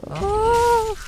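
very high sudden shriek; startled high shriek. Real, not acted. Gamer startled by creatures, high shriek. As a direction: shriek in fright.

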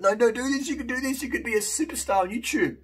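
mock his own frantic begging, high and pushy; pleading excitedly. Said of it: "I'll be like".

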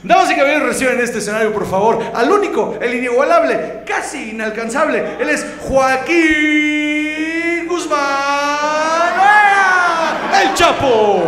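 hype announcer. Pretend. Comic as the emcee introducing El Chapo, hammed-up hype. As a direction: announce the star like a boxing emcee. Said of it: "y le dice".

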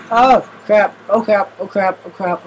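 loud high panic fading down to near normal. Real, not acted. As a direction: start yelling then calm down each time. Panic, repeated exclamation.

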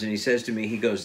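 usual speaking voice

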